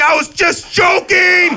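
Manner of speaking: high, slow panicked shout; hammed-up frantic yell; doctor losing it. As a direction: yell it in mock desperation, dragging it out. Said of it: "the doctor lost his mind".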